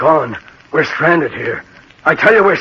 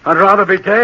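louder, rising desperate cry; desperate insistence; 5 dB louder, faster than usual. Acted. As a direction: throw it out loudly in despair. Despairing man insists he be heard.

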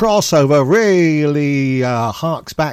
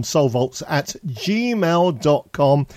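slowed right down, spelling it out; slow and clear; 3 dB quieter, much slower than usual. Shown as a demonstration. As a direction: say the email slowly so they can write it. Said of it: "It's at soul vaults at gmail dot com".

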